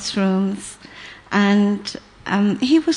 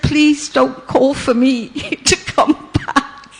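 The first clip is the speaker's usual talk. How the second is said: laughing quote; 4 semitones higher than usual. Retold or imitated. Quoting driver, laughing.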